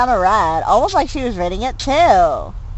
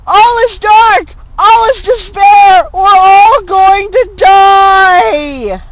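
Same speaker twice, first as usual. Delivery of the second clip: very high, loud, slow wailing despair; hammed-up tragic howl with crying; melodramatic wailing; 12 semitones higher, 9 dB louder, slower than usual. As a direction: wail like the world is ending. Melodramatic despair over owl.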